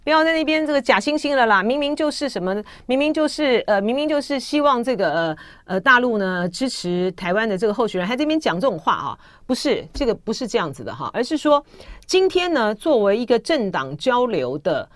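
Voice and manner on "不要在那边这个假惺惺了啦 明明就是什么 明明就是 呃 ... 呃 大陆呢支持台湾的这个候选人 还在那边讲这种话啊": imitating snide netizens, pitched up; mock a sceptic's sour complaint in a higher voice; high-pitched, scoffing imitation of online carping; sour sniping. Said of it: "就在那边酸说".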